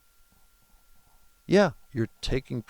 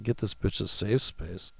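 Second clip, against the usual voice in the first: usual speaking voice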